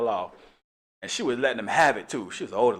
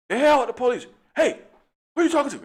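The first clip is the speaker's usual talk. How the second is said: high, loud angry shout; hostile clash; 13 semitones higher, 7 dB louder than usual. Pretend. The wrong officer and the lady squaring off, loud and angry. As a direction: bark back at her.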